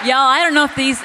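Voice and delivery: host teasing the panel for fun; teasing exclaim. Real, not acted. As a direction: rib the panel with a laugh in the voice.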